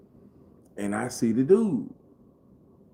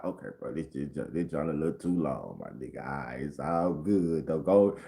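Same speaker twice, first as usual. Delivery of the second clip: awkward low; 9 semitones lower, 7 dB quieter than usual. Retold or imitated. Easing out of the hug.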